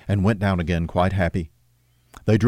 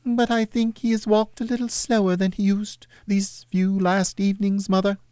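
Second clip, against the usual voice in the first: put-on Peter's boyish voice; 12 semitones higher than usual. Peter answers his mother, lightly voiced boy.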